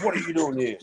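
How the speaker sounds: high-pitched imitated taunt; mock an opponent's challenge, pitched well up; Martin Pike. Opponent repeating his sledge to a rookie ignoring him, mock taunt. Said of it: "I hear this voice".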